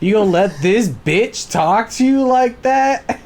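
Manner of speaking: high, loud gleeful taunting; mock-outraged coworker goading the boss; egging on. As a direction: stir the pot like a gleeful instigator.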